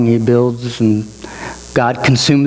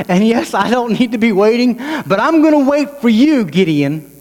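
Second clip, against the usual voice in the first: high-pitched, emphatic divine promise; impassioned preaching as God; 7 semitones higher than usual. Acted. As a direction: preach it insistently, in God's voice to Gideon. Preacher voices God's promise.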